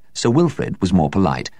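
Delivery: ordinary delivery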